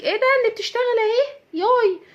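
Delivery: mocking gossip. Imagined neighbour jeering at a working wife, high mocking voice. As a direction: mimic a scornful neighbour jeering.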